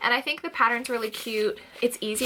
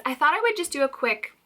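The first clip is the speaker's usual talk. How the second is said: ordinary talk, nothing marked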